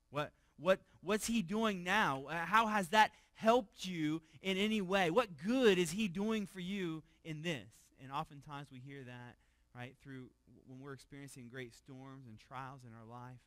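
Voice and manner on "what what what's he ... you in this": mocking taunt. Voicing a mocking scoffer.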